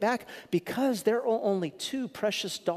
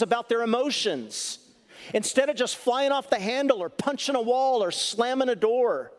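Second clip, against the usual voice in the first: impassioned; 6 semitones higher than usual. Real, not acted. Passionate preaching on men's anger.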